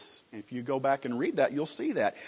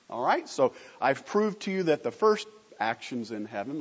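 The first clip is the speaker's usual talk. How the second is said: ordinary talk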